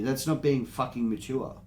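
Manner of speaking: ordinary talk, nothing marked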